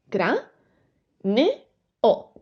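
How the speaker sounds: "cra, ne, o" with a short pause after each part; syllable by syllable. Shown as a demonstration. Teacher repeating the split for the class, syllable by syllable. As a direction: space out "cra", "ne", "o" evenly.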